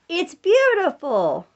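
put-on drawn-out, high and bright for a toddler to copy; slow sing-song prompt to a toddler; slow, coaxing prompt. Shown as a demonstration. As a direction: stretch it slowly so the baby can repeat. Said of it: "Can you say".

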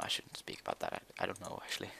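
hushed, low whisper. Real, not acted. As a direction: drop to a secretive whisper. Confides he shouldn't talk about it.

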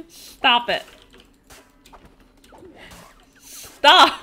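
loud protest. Real, not acted. Streamer yelling at teasing chat to stop, loud protest. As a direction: yell it at the bullies, really fed up.

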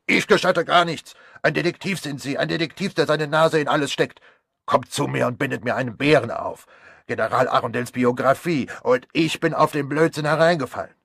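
loud, high furious tirade; angry rant. Acted. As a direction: lash out at the meddling detective. Angry doctor ranting at Poirot. (zorniger Arzt beschimpft den Detektiv, laut und hoch)

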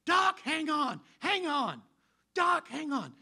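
high, loud panicked yells; screaming family. Retold or imitated. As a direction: scream it from the top of your lungs. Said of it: "They are screaming to the top of the lungs".

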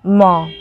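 म said as a short clear ma; म as in माँ; ma sound. Shown as a demonstration. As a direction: close the lips and say म. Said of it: "pronunciation क्या होता है".

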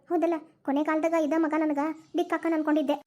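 ordinary talk, nothing marked

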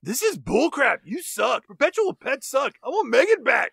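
put-on bitching kid. Child demanding her robot back, mock bitching. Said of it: "[name] is bitching about having Megan".

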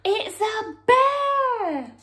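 high, loud, slow cry of alarm; gasp, then shout the discovery; frightened gasp. Acted. Story reader finding the bear, mock alarm.

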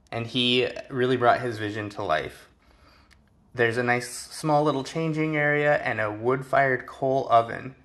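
ordinary talk

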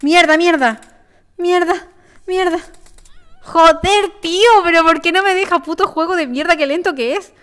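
loud high swearing at the game; frustrated shouting. Real, not acted.

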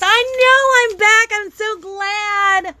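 high, slow happy coo; overwhelmed by cuteness. Real, not acted. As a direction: coo back at the chat.

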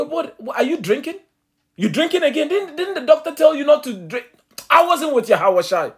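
high, loud mock-indignant retort; Peter deflecting. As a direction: turn it back on him as a drunk, scoffing. Peter deflects, mocks the accuser.

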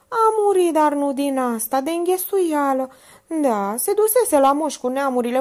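high, loud folksy storytelling voice; gossiping maid. A narrator's reading. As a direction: tell the tale with relish. Minerva tells of her aunt.

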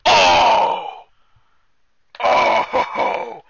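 loud drawn-out hoot at a put-down; jeering "oh!". Real, not acted. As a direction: hoot "oh!" like a heckler.

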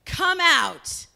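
commanding shout into the tomb; loud command. Acted. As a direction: call a dead man out of the tomb. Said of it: "said in a really loud voice".

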